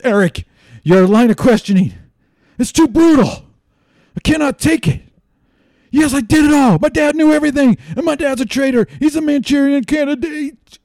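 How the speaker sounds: very high-pitched mock confession; hammed-up courtroom breakdown; Donald Trump Jr. breaking down. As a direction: squeal it out like a cracking witness. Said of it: "he just breaks down Donald J Trump Junior and says".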